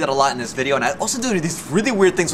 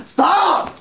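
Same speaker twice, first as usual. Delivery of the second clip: much higher, louder cry of stop; playful shout; 4 dB louder than usual. Real, not acted. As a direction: yell stop at the friend, high and squeaky.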